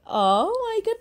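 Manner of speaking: Oh my God jumps up high; a real, startled cry of surprise; surprised gasp. Real, not acted. Reacting to hearing the voice note. (terkejut, nada melonjak tinggi)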